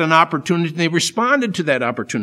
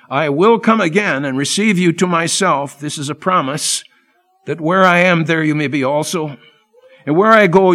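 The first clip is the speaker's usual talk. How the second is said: rising to a strong preaching voice, louder on the promises; scripture reading; 5 dB louder than usual. Acted. Preacher reading Jesus' words to his men, in full preaching voice. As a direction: proclaim the passage to the congregation. Preacher reads John fourteen aloud.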